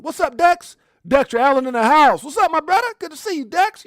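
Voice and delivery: loud, high welcome to a friend; excited hype greeting. Real, not acted. Hyped shout-out to arriving viewer.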